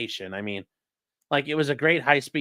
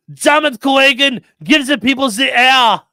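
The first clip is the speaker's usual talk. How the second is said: loud, high, hammed-up action hero quote; Total Recall movie quote; 9 semitones higher, 9 dB louder than usual. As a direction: bellow it like the desperate movie hero. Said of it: "It's like a Total Recall".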